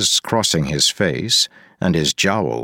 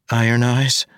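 put-on higher young woman asking; 8 semitones higher than usual. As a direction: ask it gently as a young woman. Marasi asks again.

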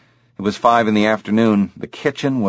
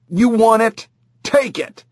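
yelling; 12 semitones higher than usual. Acted. Kids fighting at the table.

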